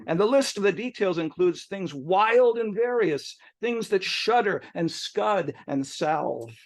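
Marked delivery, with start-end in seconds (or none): none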